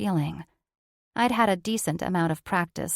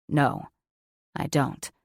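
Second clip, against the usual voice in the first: male character voice; 5 semitones lower than usual. A narrator's reading. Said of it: "he said".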